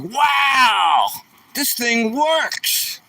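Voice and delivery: high, drawn-out retold "wow" of amazement; amazed exclamation. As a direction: relive the astonishment, pitch way up, stretching the words. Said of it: "I was like".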